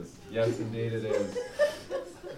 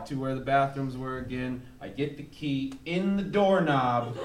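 ordinary talk

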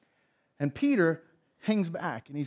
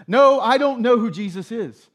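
louder, higher, insistent denial; emphatic denial; 5 semitones higher, 9 dB louder than usual. Retold or imitated. Peter brushing off a bystander who recognized him, emphatic denial. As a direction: deny it firmly, raising the voice.

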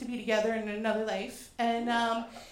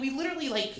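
ordinary talk

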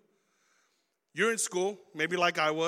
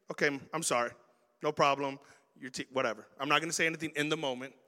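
lower, softer, conciliatory; calm apology; 5 semitones lower, 4 dB quieter, faster than usual. Retold or imitated. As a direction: back down gently. Calm apology instead of reacting.

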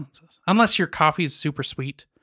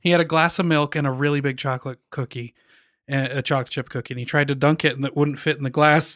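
normal talking voice

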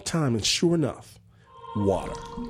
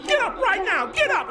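much higher, frantic mock yell; frantic shout. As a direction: yell at the kids to wake up. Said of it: "he's shouting crazy".

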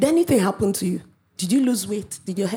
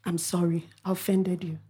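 soft, lower apology, almost pleading; a soft contrite plea to the offended person; humble apology; 5 semitones lower, 7 dB quieter than usual. Retold or imitated. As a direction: say sorry meekly under the breath. Preacher voices a humble apology.

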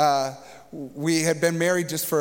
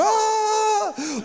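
sudden high shriek, as if terrified in a dream; mock dream scream on top of the toilet; imitated panicked scream. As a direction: let out a startled, high-pitched panic cry. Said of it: "like this".

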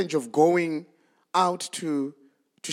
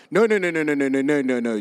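rapid protest; 4 semitones lower, faster than usual. Acted. Peter protests at Jesus's death.

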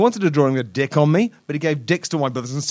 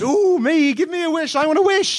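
much higher, squeaky eager begging; little gingerbread man begging the wizard, high and eager. Acted. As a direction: plead for the wish like a tiny excited child. Gingerbread man begs wizard for wish.

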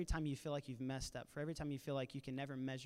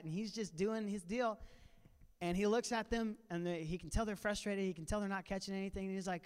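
ordinary talk, nothing marked

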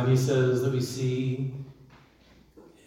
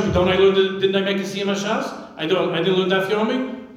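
higher, louder, pleading protest; protesting worshipper; 6 semitones higher, 3 dB louder than usual. Retold or imitated. Worshipper insisting to Hashem, higher and louder. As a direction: plead your record before God. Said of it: "then you say to Hashem".

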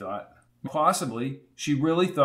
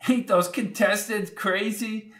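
put-on old landlady; 8 semitones higher, slower than usual. A narrator's reading. Landlady voiced.

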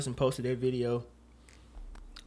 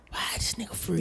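whispered disbelief; 5 semitones higher, 7 dB quieter than usual. Real, not acted. Disbelief at bail news.

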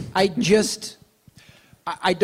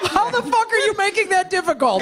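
exasperated; 12 semitones higher than usual. Real, not acted. Exasperated at guest.

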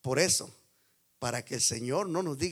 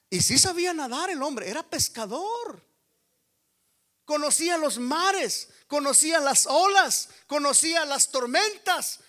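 higher, louder, rising sermon cadence; fiery preaching; 11 semitones higher, 7 dB louder than usual. Acted. Pastor preaching about Peter the fisherman, full-force preaching. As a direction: drive each line home to the crowd.

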